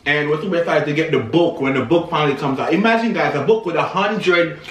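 eating between words; talking while chewing. Real, not acted. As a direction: mouth partly full. Seen 0: Both twins hold and bite bread while talking; speaker unclear.

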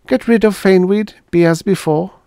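light reassurance. Acted. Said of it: "Patricia assured her".

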